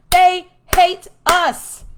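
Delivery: high, loud, slow word by word; emphatic insistence. Real, not acted. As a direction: hammer each word separately. Driving home point to audience.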